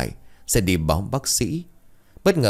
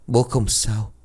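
weak voice; 3 semitones higher, slower than usual. A narrator's reading. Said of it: "giọng nói cũng có phần yếu ớt".

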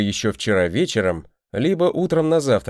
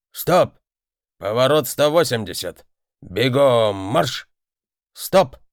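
shouting drill sergeant; slower than usual. Acted. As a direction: bark drawn-out orders across the arena. Instructor drilling the golem pilot.

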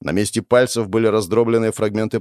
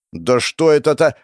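irritated; 5 semitones higher, 4 dB louder than usual. Acted. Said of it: "уже хотел злиться я".

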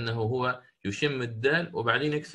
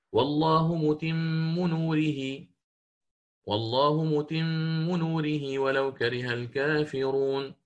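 slow chanted recitation, "نوره" ending in "نورهي"; slow Quran recitation; 2 semitones higher, much slower than usual. Shown as a demonstration. Teacher recites the verse in Hafs reading, slow chant. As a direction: recite slowly with melody, Hafs style. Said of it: "كقوله تعالى في سورة الصف".

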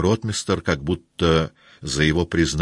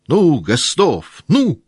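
louder, higher, slow urging with a burr; urging shout; 12 semitones higher, 8 dB louder, slower than usual. Acted. Denisov loudly urging Rostov to speak. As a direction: push Rostov to answer.

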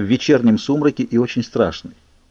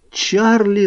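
much higher, desperate shout; shouted name; 11 semitones higher than usual. A narrator's reading. Bella screams for her father in the car, desperate shout. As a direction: cry out the name in panic. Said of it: "закричал я".

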